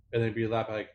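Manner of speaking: usual speaking voice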